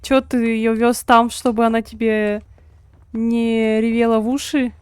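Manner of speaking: usual speaking voice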